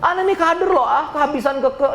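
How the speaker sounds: a hint of a mocking shopper; snide customer. Customer grumbling the item ran out, lightly mocking. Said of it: "Aneh ngomong gitu".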